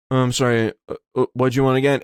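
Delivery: low-pitched, confused drive-thru worker. Retold or imitated. Drive-thru worker asking the customer to repeat, low and lost. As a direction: imitate a clueless order taker, voice dropped low. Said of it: "they'd be like".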